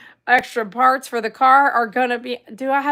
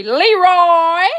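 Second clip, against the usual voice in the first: Leroy called out loud and higher; group-cheer call; 4 semitones higher, 6 dB louder than usual. Shown as a demonstration. Streamer leading viewers in saying Leroy, loud drawn-out call. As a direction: belt out a drawn-out Leroy on cue. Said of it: "Y'all say Leroy now".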